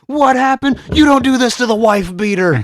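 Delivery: high, loud hammed-up growling yell; mock growly wrestler shout. Parodying the clip for her co-host, loud mock growling shout. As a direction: ham up the angry wrestler. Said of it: "So unnecessarily growly and".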